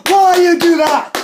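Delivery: high, loud wail; outraged yell. Acted. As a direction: demand an answer at a yell. Wailing over the hack.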